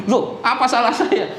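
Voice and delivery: high-pitched, indignant surprise, acted out; retold startled objection, pitched well up; startled protest. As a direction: imitate a shocked father protesting his innocence. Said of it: "Oh, kaget".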